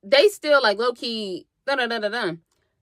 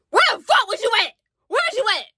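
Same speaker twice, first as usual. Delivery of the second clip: higher, louder imitated demand; mock high outraged demand; 10 semitones higher, 3 dB louder, faster than usual. Rakai demands where she was, high mock outrage.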